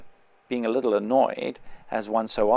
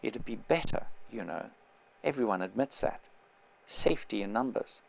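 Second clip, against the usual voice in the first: low, much softer confiding murmur; dropped voice; 3 semitones lower, 8 dB quieter than usual. Acted. On the bus, confiding to the narrator with a dropped voice. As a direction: lean in and drop your voice to a hush. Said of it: "here he dropped his voice".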